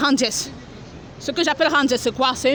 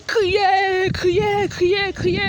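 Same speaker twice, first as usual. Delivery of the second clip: retold crying out; 8 semitones higher, much slower than usual. Preacher relives her cry of judgment at the church. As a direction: reenact the cry, higher each time. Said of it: "je me suis mise à crier".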